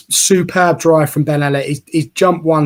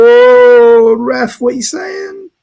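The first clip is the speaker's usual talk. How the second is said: outraged at ref; 11 semitones higher, 9 dB louder, much slower than usual. Real, not acted. Fan protesting referee's call.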